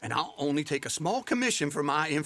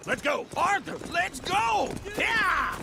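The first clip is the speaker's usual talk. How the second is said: urgent call. Acted. Partner urges Arthur to flee now, high urgent shout. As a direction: yell to get Arthur moving.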